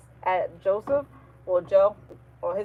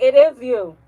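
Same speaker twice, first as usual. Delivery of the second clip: loud, higher mock accusation; accusing; 4 semitones higher, 11 dB louder, slower than usual. Friends telling Bree she's to blame, accusing loudly. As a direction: throw the blame back loudly.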